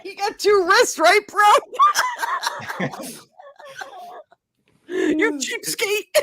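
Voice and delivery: high, shouting and laughing mock salesman; laughing mock upsell. Mocking the donor into a pricier watch: laughing shout. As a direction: push the gold watch through laughter.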